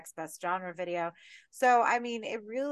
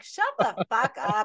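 slow, louder fed-up snap at the screen; mock angry retort; 3 semitones higher, 8 dB louder than usual. Ranting at a film character, mock angry retort. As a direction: tell the character off, slowly. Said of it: "I was so mad".

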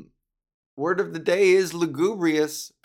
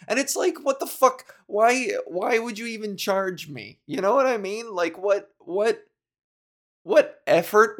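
high, incredulous outrage; rising disbelief at the bank; exasperated; 6 semitones higher, 3 dB louder than usual. Real, not acted. Ranting at bank charge.

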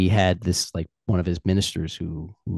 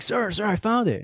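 much higher, breathless with triumph; excited minister. Retold or imitated. Minister rushes to King Solomon, excitedly much higher. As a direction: burst in proud to the king. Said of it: "He's like".

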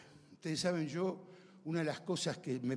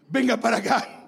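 high, loud soldier's command; acted-out guard's yell; shouted order; 6 semitones higher, 9 dB louder than usual. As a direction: order someone over forcefully.

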